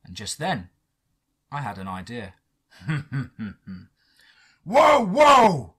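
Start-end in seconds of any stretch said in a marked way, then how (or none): 2.8-3.8 small closed-mouth laugh; scheming chuckle. Acted. Narrator scheming after having an idea. 4.6-5.7 high, loud, drawn-out calls; big sustained shout; shouting halt. A narrator's reading. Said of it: "he shouted".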